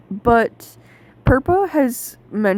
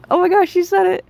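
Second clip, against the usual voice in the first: high, louder excited burst; thrilled outburst, pitched way up; excited reaction; 11 semitones higher, 6 dB louder, faster than usual. Retold or imitated. Fan reliving hearing the name said, excited outburst. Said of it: "and I was like".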